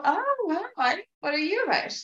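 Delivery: high, curious oh wow; curious surprise. Retold or imitated. Greeting her own trigger with high curious surprise. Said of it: "I just go".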